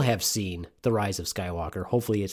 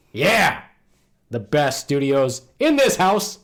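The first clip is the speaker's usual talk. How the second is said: loud, high podcast hype; big hollered intro; hyped show intro; 5 semitones higher, 6 dB louder, slower than usual. Acted. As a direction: shout it out like a show opener.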